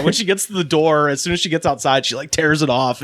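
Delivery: ordinary delivery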